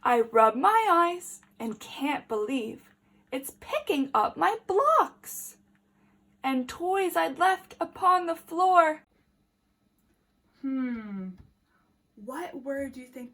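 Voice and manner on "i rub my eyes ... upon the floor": put-on lively read-aloud for small children; story reading. A narrator's reading. Teacher reading a picture book to the class, lively.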